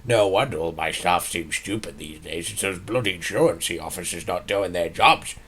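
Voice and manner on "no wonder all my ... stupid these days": usual speaking voice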